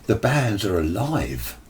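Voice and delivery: ordinary talk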